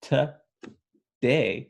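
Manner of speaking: to-DAY, slow, light first and strong second syllable; iambic stress. Shown as a demonstration. Teacher demonstrating the word today as an iamb. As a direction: stretch today into a clear short-long. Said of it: "short, long".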